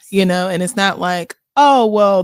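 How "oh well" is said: loud, put-on "oh, well"; mock excuse-making. Host mimics people who delay their self-care, mock excuse-making. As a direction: play someone putting it off, loudly.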